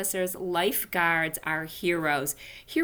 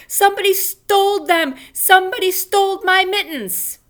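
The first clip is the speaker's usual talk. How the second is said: frantic high yell, much louder; hollering; 13 semitones higher, 10 dB louder than usual. Acted. Junie B hollering for help over her mittens, frantic yell. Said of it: "I hollered".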